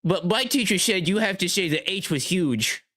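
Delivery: lightly voiced whining kid; mock whiny child. Mocking a critic as a phonics kid, light child voice. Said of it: "five-year-old in phonics".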